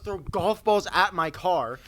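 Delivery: normal talking voice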